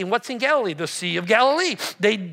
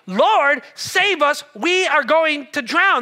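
loud, raised cry for rescue; panicked disciples; 4 semitones higher, 6 dB louder, slower than usual. Acted. Disciples waking Jesus as the boat rocks, panicked plea. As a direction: plead urgently, high and loud. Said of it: "These guys were flipping out".

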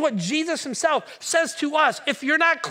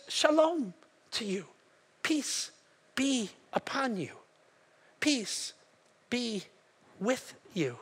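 soft, spaced-out benediction; gentle blessing; 6 dB quieter, slower than usual. Retold or imitated. Jesus blessing his followers with peace, gentle and soft. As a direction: bless them softly, pausing between phrases.

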